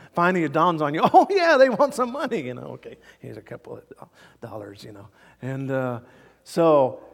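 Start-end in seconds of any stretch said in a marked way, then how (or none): 1.0-2.4 higher, louder dawning "oh yeah"; a loud lightbulb moment; sudden realization. Acted. Guest suddenly realizing the porter wants a tip, louder and higher. 2.7-4.8 resigned mumble. Acted. Guest handing over tip.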